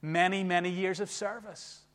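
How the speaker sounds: ordinary delivery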